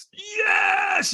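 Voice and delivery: very high, long-held yell; reenacted scream. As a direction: let out an all-out mimed battle yes. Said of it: "I screamed".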